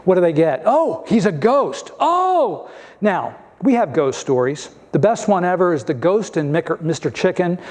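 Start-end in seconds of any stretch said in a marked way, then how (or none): 0.7-2.7 hammed-up frightened cry, the last Oh jumping high; mock fright. Disciples in the boat spotting a ghost, hammed-up fright. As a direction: play the disciples as spooked, rising into a yelp.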